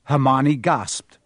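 ordinary delivery, nothing marked

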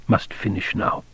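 breathy whispered aside to himself. A narrator's reading. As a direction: breathe it out under your breath. Private thought, resolving to read on.

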